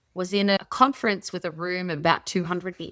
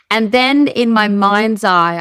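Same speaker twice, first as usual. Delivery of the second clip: emphatic; 3 semitones higher, 9 dB louder, slower than usual. Real, not acted. Storytelling build-up.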